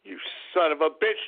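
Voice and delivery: usual speaking voice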